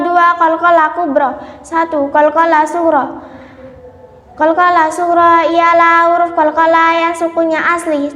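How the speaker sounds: usual speaking voice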